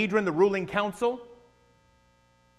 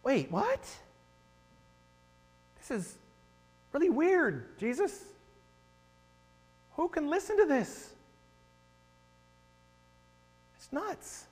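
higher, puzzled mock disciple voice; confused disciples; 8 semitones higher, 5 dB quieter than usual. Preacher voicing disciples baffled by Jesus's words, high puzzled tone. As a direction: lightly act out a baffled follower. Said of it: "his own disciples are hearing this going".

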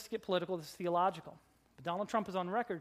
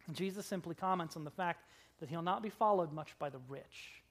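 ordinary talk

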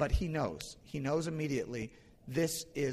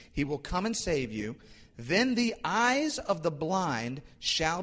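higher, drawn-out proclamation of scripture; impassioned scripture reading; 4 semitones higher, 4 dB louder than usual. Acted. Preacher reads the Messianic text to the congregation, proclaiming. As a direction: proclaim the prophecy, lingering on key phrases.

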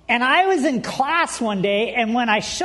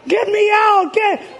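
high, loud mock-panic cry; panicked; 9 semitones higher, 4 dB louder than usual. Boy stuck in the window as teacher nears, mock panicked cry. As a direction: yell for help in hammed-up panic. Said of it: "and now I panic".

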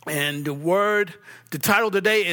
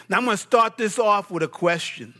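ordinary talk

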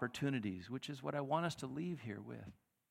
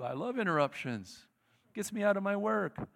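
louder, higher, flippant; mock cheerful; 7 semitones higher, 6 dB louder than usual. Slacker in the crowd, loud and flippant. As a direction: play the slacker gleefully. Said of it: "people in a crowd who do".

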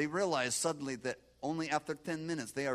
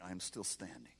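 whisper; 5 semitones lower, 7 dB quieter than usual. A narrator's reading. Quiet telling of midnight vigil.

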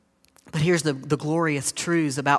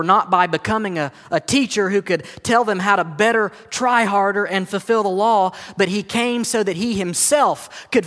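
raised, driving sermon voice; impassioned preaching; 5 semitones higher, 6 dB louder than usual. Real, not acted. Preacher tells congregation why Jesus came, impassioned preaching. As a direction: preach it higher and louder, building momentum.

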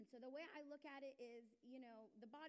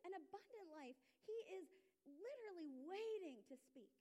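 full-force preaching; 8 semitones higher, 6 dB louder than usual. Acted. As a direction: preach it out with rising fervour.

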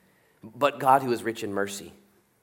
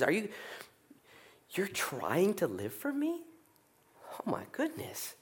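high, hushed, amazed tenderness; tender God voice; 11 semitones higher, 8 dB quieter than usual. Acted. God gently marvelling at a struggling believer. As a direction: breathe it out in delighted wonder.

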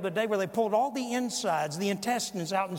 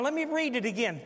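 ordinary delivery

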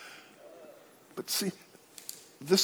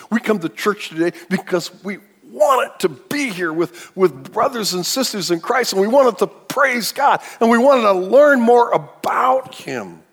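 higher, louder, ringing preacher's cry; preaching at full force; 10 semitones higher, 8 dB louder than usual. Acted. Preacher to congregation, full-force preaching. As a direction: lift the room with it, stretching the last line.

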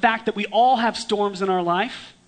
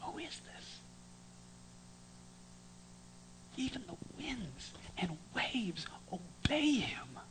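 whisper, slow and much softer; whispered murmur; 2 semitones higher, 19 dB quieter than usual. Acted. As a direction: murmur around the boat. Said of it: "a quiet murmur".

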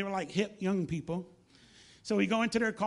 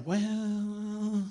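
long, drawn-out hesitant "well"; imitated reluctance. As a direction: stall before admitting something awkward. Voicing a reluctant young man.

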